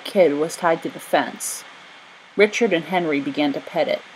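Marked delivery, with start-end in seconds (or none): none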